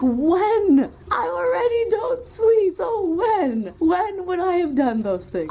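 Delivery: pleading, close to crying; near tears. Real, not acted. Venting to fans, voice breaking near tears. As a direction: voice cracking high with upset. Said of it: "I am gonna go cry".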